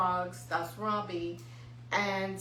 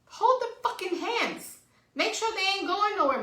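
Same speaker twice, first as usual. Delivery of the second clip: high, heated rant; pissed off; 10 semitones higher, 4 dB louder than usual. Real, not acted. Ranting at careless parents in stores, pissed off. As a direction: lay down the law, pitched way up and emphatic. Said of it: "That shit pissed me off".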